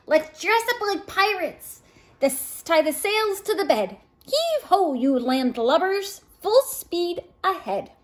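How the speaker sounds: high, loud hearty pirate calls; pirate play. A narrator's reading. Children playing pirates on the bed, loud hearty calls. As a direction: call Heave ho like a ship's captain.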